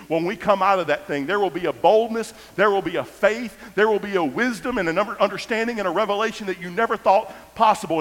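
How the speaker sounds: high, loud sermon climax; full-force preacher's cadence; fiery preaching. Acted. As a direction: preach the promise with rising fire.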